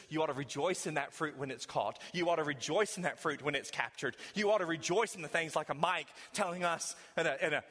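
ordinary talk, nothing marked

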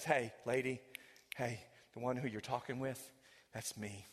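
low, hushed reveal, fading away; tenderly revealing a secret; soft revelation. Acted. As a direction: let it drop to a near hush. Jesus reveals himself softly.

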